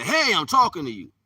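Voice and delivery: high, loud demanding shout. Acted. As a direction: shout to get their attention. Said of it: "raise his voice and say".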